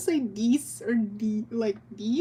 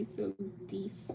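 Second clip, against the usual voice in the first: whispered demo; 5 semitones lower, 13 dB quieter than usual. Shown as a demonstration. Demonstrates odd pronunciation, whispered.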